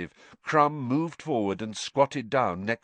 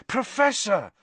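urgent; 12 semitones higher, 3 dB louder than usual. A narrator's reading. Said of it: "Harry said".

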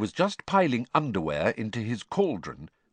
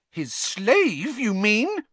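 much higher, louder indignant retort; voice rising shrilly; 14 semitones higher, 5 dB louder, much slower than usual. A narrator's reading. Girl shrilly retorting to Percy about servants. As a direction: let the voice climb high in outrage. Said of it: "her voice rising shrilly".

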